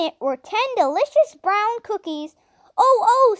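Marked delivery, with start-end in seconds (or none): 2.8-3.4 loud, high excited oh oh; cried. A narrator's reading. Little brother seeing cookies, loud high cry. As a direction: squeal over the cookies. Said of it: "cried Benny".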